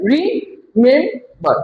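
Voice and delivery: রিমেম্বার slowly as RE, pause, MEM, pause, BAR; three separate beats on রিমেম্বার; broken into syllables. Shown as a demonstration. Teacher repeats the count for class, রিমেম্বার split slowly. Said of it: "তিনবারে".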